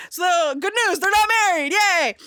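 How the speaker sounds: a teller cheering that the couple is not married; excited cheer. Real, not acted. Cheering the wedding didn't count.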